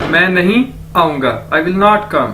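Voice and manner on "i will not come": usual speaking voice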